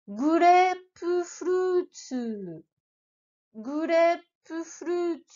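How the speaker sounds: Grapefruit, slow, long vowels held; Japanese grapefruit with long vowels. Shown as a demonstration. Teacher stressing the vowel lengthening for learners. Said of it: "pense à bien les prononcer".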